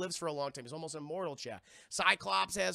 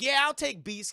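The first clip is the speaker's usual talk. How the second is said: higher, louder, picking the one he likes; loud eager pick; 6 semitones higher, 8 dB louder than usual. Retold or imitated. Professor X loudly choosing Beast for his team. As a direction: shout out the choice like a coach picking a player. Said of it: "He's like".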